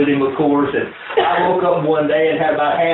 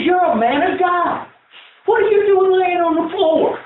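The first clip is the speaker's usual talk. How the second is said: incredulous mentor; 13 semitones higher than usual. Retold or imitated. Mentor rebuking the grieving pastor, high and incredulous. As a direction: act stunned the preacher is on the floor.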